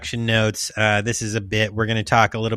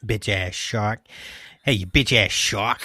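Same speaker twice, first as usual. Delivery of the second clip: Bitch ass shark in a put-on New England accent, a bit lower; 3 semitones lower, 4 dB quieter than usual. Host taunting the shark in a New England accent. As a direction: tough Boston guy taunting the shark. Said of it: "The accent's perfect 'cause it's New England".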